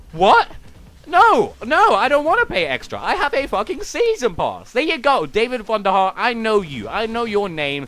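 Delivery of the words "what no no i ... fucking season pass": mock outrage. Mock outraged fan protest.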